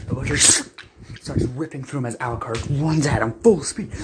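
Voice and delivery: normal talking voice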